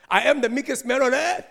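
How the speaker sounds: loud, high, mock self-declaration; Moses boasting. Preacher mimics Moses writing of his own meekness, boasting loudly. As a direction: proclaim it grandly, high and loud.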